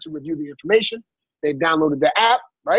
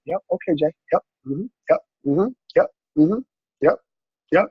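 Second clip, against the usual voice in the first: patient listening. Acted. Acts out patient listening on a call.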